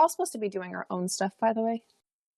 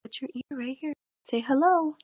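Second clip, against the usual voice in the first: higher, sing-song voice to get a little one to say hello; 4 semitones higher than usual. Real, not acted. Mother coaxing her daughter to greet the guest, sing-song.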